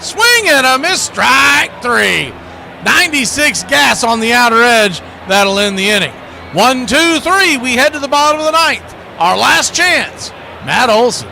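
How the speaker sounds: roaring broadcaster hype for the ninth; excited strikeout call. Real, not acted. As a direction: sell the strikeout and the last chance. Strikeout call, ninth inning drama.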